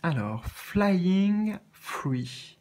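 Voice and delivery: slow, careful French-accented card title; French-accented English. Shown as a demonstration. Reading the card title to viewers in French-accented English. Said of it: "désolé pour mon accent".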